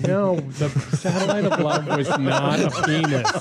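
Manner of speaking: laughing through the words; talking while laughing. Real, not acted. Teasing co-host about old joke.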